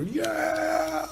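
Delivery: high, drawn-out crowd cheer; long high whoop of approval; imitated cheering. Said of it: "start cheering".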